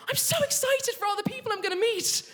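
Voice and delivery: high, gushing mock-excited mum; thrilled mum. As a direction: gush like a mum thrilled for herself.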